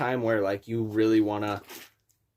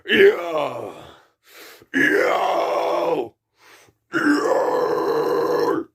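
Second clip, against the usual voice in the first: deep rasping growl; 4 semitones higher, 5 dB louder, much slower than usual. Shown as a demonstration. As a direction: build from a low growl into a full scream. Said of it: "it's gonna sound like this".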